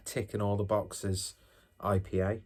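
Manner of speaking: normal talking voice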